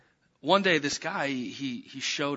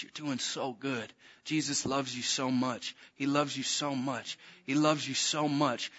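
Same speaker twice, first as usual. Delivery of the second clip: tender reassurance; 6 dB quieter than usual. Retold or imitated. Minister comforting a tormented girl he holds, tender reassurance. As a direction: soothe gently, unhurried and hushed at first. Soothing the manifesting girl with love.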